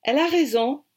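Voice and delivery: put-on high, loud British-accented agreement; emphatic British-accented French. A narrator's reading. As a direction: back her up as an eager Englishman. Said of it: "avec son accent british".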